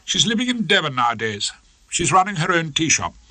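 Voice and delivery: a hint of a haughty wife; wife announcing plans, cool; Hilda voice. Hilda continuing coldly.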